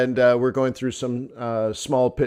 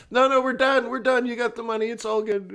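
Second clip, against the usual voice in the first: high, laughing we're done; mock breezy friend; laughing dismissal; 9 semitones higher than usual. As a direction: close the deal with a chuckle.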